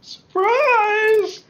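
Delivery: high, loud, drawn-out yell; spring it on them at full volume; shouted surprise. Acted. Acts out surprising the enemies.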